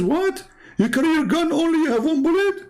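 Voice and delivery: can't believe he has one bullet; shocked disbelief. Retold or imitated.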